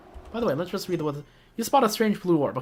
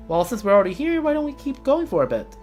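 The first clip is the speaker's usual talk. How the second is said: Paimon voice; 6 semitones higher than usual. Acted. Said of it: "too tired to do Paimon voice".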